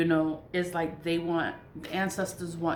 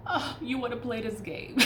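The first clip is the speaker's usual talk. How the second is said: mock taunt; 7 semitones higher than usual. Mocking the attacker.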